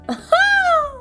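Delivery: sudden high laugh; real squealing laugh; high burst of laughter. Real, not acted.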